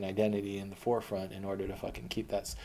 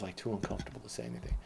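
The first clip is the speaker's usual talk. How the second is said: ordinary talk, nothing marked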